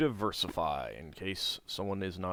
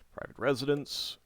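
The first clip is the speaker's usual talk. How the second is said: normal talking voice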